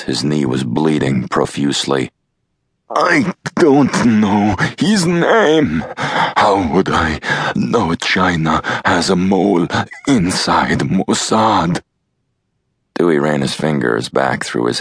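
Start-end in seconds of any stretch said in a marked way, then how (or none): 2.9-11.8 much higher, slow, pained pleading; in agony. Acted. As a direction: cry it out through the pain of a shattered knee. After being shot in knee.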